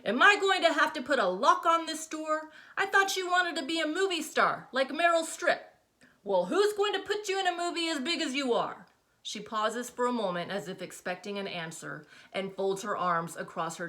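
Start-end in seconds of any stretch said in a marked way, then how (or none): 0.0-8.9 much higher, louder mocking mom voice; exasperated mother. A narrator's reading. Mother mocking daughter's movie star dream, exasperated. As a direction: ridicule her dream, hands flung out. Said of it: "throws them out in front of her".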